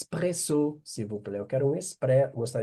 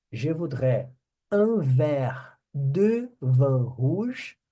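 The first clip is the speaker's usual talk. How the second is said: un verre de vin rouge said very slowly; slow demo; 4 dB louder, much slower than usual. Shown as a demonstration. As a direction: stretch every word for the class. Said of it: "teacher models phrase".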